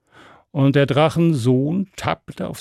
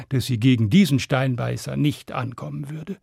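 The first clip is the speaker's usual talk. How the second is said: plain reading voice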